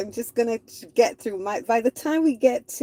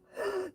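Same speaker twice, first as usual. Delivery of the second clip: mock gasp; 11 semitones higher, 10 dB quieter than usual. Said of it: "everyone stays inside and go".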